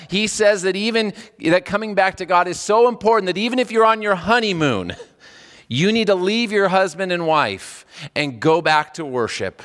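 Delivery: ordinary delivery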